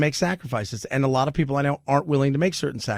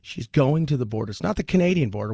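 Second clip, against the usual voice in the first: ordinary delivery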